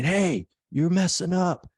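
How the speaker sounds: imitating a coach barking from the sideline; coach yelling. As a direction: yell at a player like a coach. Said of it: "on the sideline yelling at you".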